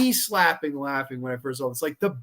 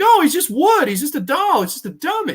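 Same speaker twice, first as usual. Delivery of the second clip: high, loud, mocking singsong; 11 semitones higher, 6 dB louder than usual. He mocks the idea that the dummy lives, high-pitched. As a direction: brush it off in a silly high voice. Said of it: "So he's like".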